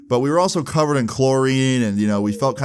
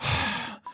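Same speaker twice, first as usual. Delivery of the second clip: annoyed sigh; 8 dB quieter than usual. Retold or imitated. Annoyed driver voiced in story.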